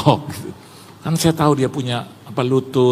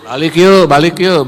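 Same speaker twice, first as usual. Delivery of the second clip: louder, higher call to go; re-enacted hurried send-off; urging to leave; 4 semitones higher, 7 dB louder than usual. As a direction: act out a guest rounding up friends. Retold friend urging guests to leave.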